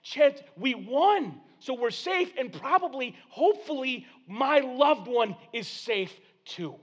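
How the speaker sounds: high, excited and relieved; celebrate, then slow down hoping; joyful townsperson, softening to hope; relieved cheering. Acted. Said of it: "people began celebrating and cheering, going".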